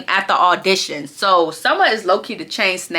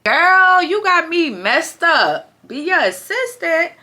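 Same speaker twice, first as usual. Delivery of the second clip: high slow sassy put-down; 7 semitones higher, slower than usual. Real, not acted. Vlogger refusing to be an assistant, high sass. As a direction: draw it out high, unimpressed.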